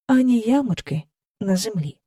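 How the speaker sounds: usual speaking voice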